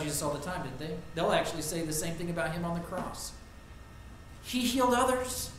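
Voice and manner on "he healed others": higher, louder jeering taunt; mocking crowd. Preacher voicing the crowd that taunts Jesus, jeering. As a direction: sneer it like the crowd at the cross.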